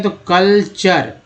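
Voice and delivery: Culture drawn out slowly with a falling tune; slow model word. Shown as a demonstration. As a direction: say culture slowly for students to copy. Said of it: "C O L को कल बोलते हैं". (Culture धीरे और साफ़)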